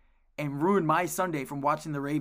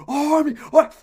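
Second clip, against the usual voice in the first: sudden loud yell, much higher; yelling across the field; 13 semitones higher, 15 dB louder than usual. Retold or imitated. Coach yelling at a kid who broke the zone, loud high yell. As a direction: bellow it at the player mid-game.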